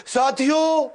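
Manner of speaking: high, loud, drawn-out "साथियों"; slow orator. Retold or imitated. Coach mimics speakers who waste time, slow drawn-out address. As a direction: stretch it like a time-wasting speaker. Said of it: "धीरे से बोलते".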